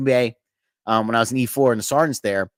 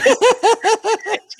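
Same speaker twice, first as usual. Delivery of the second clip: cackling laugh, high and loud; big high laugh; 6 dB louder than usual. Real, not acted. Laughing at his own story with the podcast host, loud high cackle. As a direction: burst out laughing.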